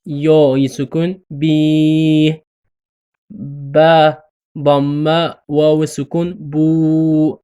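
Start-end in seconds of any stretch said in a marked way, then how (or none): 1.3-2.4 بی with a long drawn "ee"; long bee. Shown as a demonstration. Teacher demonstrating ba with long ya, drawn out. 6.5-7.4 بو with a long drawn "oo"; long boo. Shown as a demonstration. Teacher demonstrating ba with long waw, drawn out.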